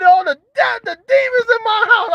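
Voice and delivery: hammed-up scared wail; mock terror. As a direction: shriek it like demons came in. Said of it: "I'm like".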